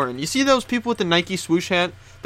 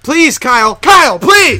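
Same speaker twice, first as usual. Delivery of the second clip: much higher, louder begging wail; high pleading shout; 9 semitones higher, 12 dB louder, slower than usual. Acted. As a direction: beg him at full volume.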